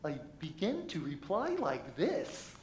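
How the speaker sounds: put-on sing-song, swooping kids' show host voice; Barney voice. Shown as a demonstration. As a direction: bounce the pitch up and down like a cheery dinosaur. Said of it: "in this voice".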